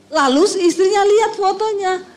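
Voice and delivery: ordinary talk, nothing marked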